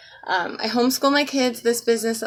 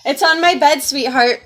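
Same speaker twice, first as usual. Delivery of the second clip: louder, calling to the next room; yelling across the room; 5 semitones higher, 7 dB louder than usual. Real, not acted. Repeats louder to child elsewhere.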